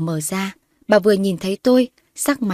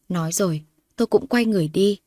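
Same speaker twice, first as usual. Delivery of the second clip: usual speaking voice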